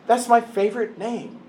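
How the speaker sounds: a loving father asking to be called Pops; God the Father, inviting. Acted. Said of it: "And yet God says".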